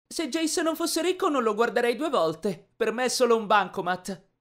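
put-on high-pitched girlfriend voice; gold-digger girlfriend. A narrator's reading. Gold digger talking about her rich boyfriend, high put-on voice. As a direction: put on a high female character. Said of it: "La sentii dire anche".

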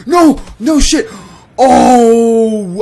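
high, very loud disbelieving yells; excited shout. Real, not acted. Gamer lands an unexpected kill, excited shout. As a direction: cry out at an unbelievable lucky hit.

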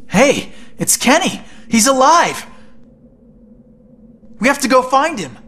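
excited shout on finding a lost friend alive; excited relief. Acted. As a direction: call it out, thrilled he's alive. Finds missing friend alive.

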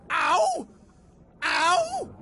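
high, drawn-out "ow"; pained yelp. Real, not acted. Player hurt by stinging tentacles in game, yelping. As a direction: yelp at each hit.

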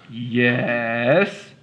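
grumpy giant. Acted. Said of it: "said the giant grumpily".

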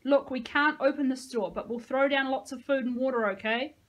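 shouted offer. A narrator's reading. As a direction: bargain over the gate. Shouting to outsiders.

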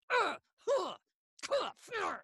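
sharp high punching grunts; striking grunts. Shown as a demonstration. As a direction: grunt each punch, teeth shut. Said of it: "I'm gonna land a punch on you".

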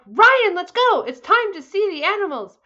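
high and loud, bursting with excitement; eager morning shout; excited wake-up call. Acted. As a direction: call him out of bed, thrilled. Sarah wakes Ryan for safari.

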